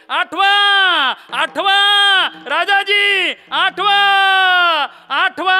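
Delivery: high loud alarm cries; guards shouting. Acted. Messengers shouting the news to King Kansa, loud. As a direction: yell the alarm at full pitch. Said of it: "ओरडायला लागले".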